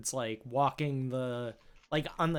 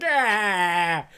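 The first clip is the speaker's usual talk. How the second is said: mock pain yell; 6 semitones higher, 10 dB louder than usual. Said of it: "You're just like".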